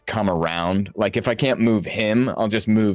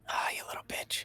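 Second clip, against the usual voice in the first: whispered insult under the breath; 15 dB quieter, faster than usual. Acted. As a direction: hiss it under your breath. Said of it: "I say that under my breath".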